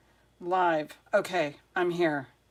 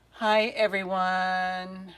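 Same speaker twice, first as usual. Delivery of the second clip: normal talking voice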